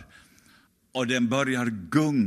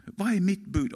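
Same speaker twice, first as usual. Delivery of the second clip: 1 Jesus's question raised; 9 semitones higher than usual. Acted. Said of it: "Och han säger".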